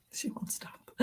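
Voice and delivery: hushed, exasperated whisper about the cat; breathy aside, eyes rolling. Real, not acted. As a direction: drop to a whisper, fed up.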